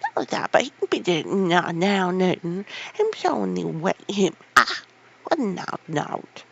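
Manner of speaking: put-on loud, outraged old gardener; angry old man, loud and scornful; outraged call. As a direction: fling the words down at her. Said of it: "he called out to her".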